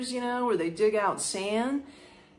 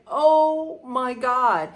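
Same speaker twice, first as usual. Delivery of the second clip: exasperated; 4 semitones higher, 6 dB louder, much slower than usual. Retold or imitated. Girlfriend exasperated.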